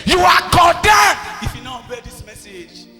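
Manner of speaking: ordinary delivery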